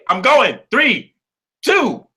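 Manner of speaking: high, loud countdown, rising; excited countdown. Real, not acted. Countdown to end guest.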